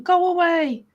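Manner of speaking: mildly cross dismissal; angry chef. Acted. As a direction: send them off like a cross chef. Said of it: "He looks angry".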